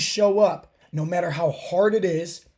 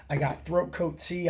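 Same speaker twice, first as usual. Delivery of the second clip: usual speaking voice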